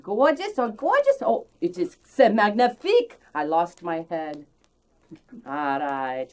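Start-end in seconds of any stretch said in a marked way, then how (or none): 0.0-3.2 high, loud delighted gush in French; gushing French exclamation. Real, not acted. Host gushing over her salad, high and loud delighted. Admiring the finished dish.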